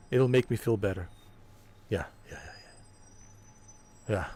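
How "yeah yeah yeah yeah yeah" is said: breathy whispered yeahs; fanboy soothing himself in a whisper; whispered mock reassurance. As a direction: whisper yeah to yourself.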